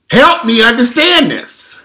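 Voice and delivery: high, loud and slow disbelief; exasperated plea. Real, not acted. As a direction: plead with the crowd in baffled disbelief.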